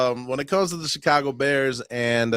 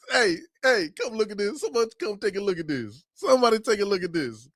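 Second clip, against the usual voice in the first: ordinary talk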